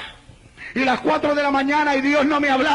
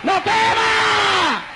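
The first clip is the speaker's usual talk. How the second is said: prophetic cry; 3 semitones higher than usual. Retold or imitated. Sister delivers God's message to his servant, mounting prophetic shout. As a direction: voice climbing into a drawn-out shout. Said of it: "sale corriendo en profecía".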